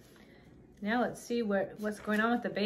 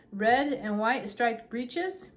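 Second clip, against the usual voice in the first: ordinary delivery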